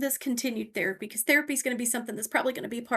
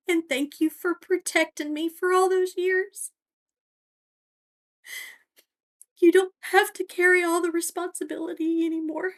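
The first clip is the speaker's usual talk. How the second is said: higher, tearful voice breaking through a letter; crying while reading; 7 semitones higher, 3 dB louder than usual. Real, not acted. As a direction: read the letter through real tears. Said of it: "sorry, I'm crying and I can't read".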